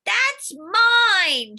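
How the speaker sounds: high loud protest from the floor; shouting. Acted. Knocked-down boy shouts at the bully, high and loud. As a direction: yell it up from the floor. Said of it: "shouts Schubert from the floor".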